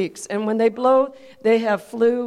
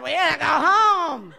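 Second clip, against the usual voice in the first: slow, slurring drunk impression; 3 semitones higher than usual. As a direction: draw it out like a comic drunk. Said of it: "The drunk said".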